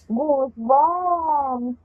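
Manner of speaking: slow, awed goosebumps; drawn-out exclamation. Real, not acted. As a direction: stretch the word out slowly. Reacts to the creepy scene.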